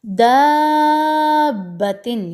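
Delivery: long vowel held six counts at a steady pitch; stretched recitation. Shown as a demonstration. Tajweed teacher showing students the six-count madd, slow and drawn out. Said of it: "मैंने छः हरकत खींचा है".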